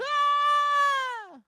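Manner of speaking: high, loud imitated scream; mock scream. Daughter shrieking loudly on the ride. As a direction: let out a high thrill scream. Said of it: "Julie was over there going".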